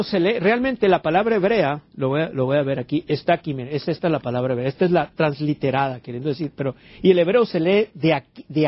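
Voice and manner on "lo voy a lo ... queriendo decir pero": ordinary talk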